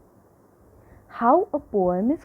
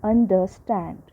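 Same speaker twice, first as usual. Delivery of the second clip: ordinary talk, nothing marked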